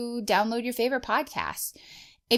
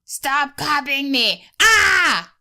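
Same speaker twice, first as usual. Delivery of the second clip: high, loud, slow shouting guardian; Crystal Guardian impression; 7 semitones higher, 8 dB louder, much slower than usual. Shown as a demonstration. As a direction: yell it as a furious crystal guardian. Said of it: "My impression is gonna be the Crystal Guardian, Finn style".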